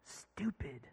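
low, secretive whisper; hushed naughty-word confession; imitated child's whisper. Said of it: "she whispers".

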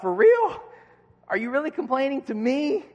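high, loud "for real?"; mock disbelief at a petty complaint; incredulous disbelief. Single friend hearing married friends complain, incredulous.

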